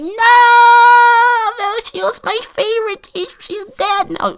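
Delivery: very high mock wail of horror; screaming girls. Imitating girls mourning their favorite teacher, mock scream. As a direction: imitate a distraught girl screaming in falsetto. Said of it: "screaming, like".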